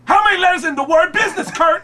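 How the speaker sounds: mock-outraged high shout; loud, high comic interrogation; Tracy Morgan. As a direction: hammer it out in a hammed-up impersonation. Tracy yelling at Kurt, acted.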